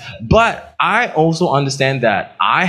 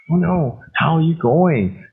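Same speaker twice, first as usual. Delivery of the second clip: sympathetic concern; 7 semitones higher than usual. Retold or imitated. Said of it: "I was like".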